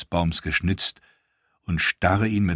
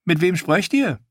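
much higher, louder woman's voice cutting in; strange voice asks; 12 semitones higher, 3 dB louder than usual. Acted. Washerwoman surprising Theon at the tree, high voice. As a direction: startle him from behind. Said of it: "Eine Stimme fragte".

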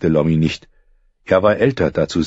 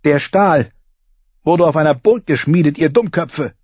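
a hint of an angry girl's hiss; 9 semitones higher than usual. Arya defending her sword to the boys, hissing back. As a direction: snap back at them, offended. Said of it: "fauchte sie die beiden an".

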